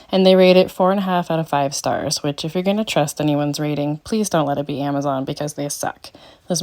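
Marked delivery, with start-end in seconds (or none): none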